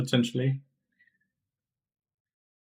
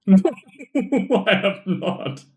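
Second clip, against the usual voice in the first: laughing; 6 semitones higher, 4 dB louder, much slower than usual. Real, not acted. Protesting editor's claim, laughing.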